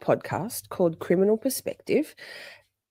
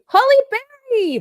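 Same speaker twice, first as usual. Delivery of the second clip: excited call; 6 dB louder than usual. Real, not acted. Greeting chatter by name, sing-song.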